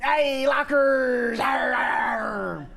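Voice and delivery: slow hammed-up pirate yell; loud mock pirate cry; pirate shout. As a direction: belt it like a pirate. Pirate bit begins.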